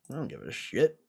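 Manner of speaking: ordinary delivery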